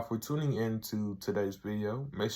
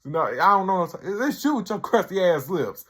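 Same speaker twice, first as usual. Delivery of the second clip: louder, higher mock comeback; retold insult; 8 semitones higher, 6 dB louder than usual. Tyrone insulting Rhonda at the intervention, louder mock retort. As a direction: fire back an insult, hammed up.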